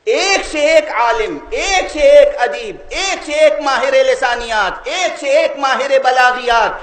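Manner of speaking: high, ringing, repeated cadence; declaimed sermon list. Real, not acted. Preacher lists scholar after scholar, declamatory preaching.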